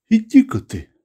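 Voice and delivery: high, irritated brush-off. A narrator's reading. Ivan Gavrilovich brushing off the old man's bet, irritated. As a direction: cut him off in annoyance. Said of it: "раздражённо отрезал Иван Гаврилович".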